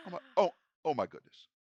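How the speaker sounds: loud, fast astonished outburst; amazed. Real, not acted. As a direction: blurt it out in amazement. GM reacts to a roll.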